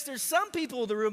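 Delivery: ordinary talk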